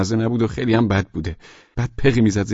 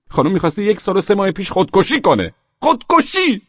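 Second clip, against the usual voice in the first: high-pitched, incredulous scorn rising to a cry; scornful outburst; 7 semitones higher than usual. Acted. Husband rants at absent wife over suicide, scornful outburst. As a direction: throw it out in high, mocking outrage.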